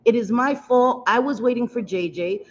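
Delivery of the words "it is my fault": normal talking voice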